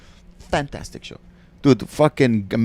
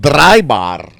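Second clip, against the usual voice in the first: loud, high mock Arabic accent; thick Arabic accent; 5 semitones higher, 10 dB louder than usual. Shown as a demonstration. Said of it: "with a thick Arabic accent".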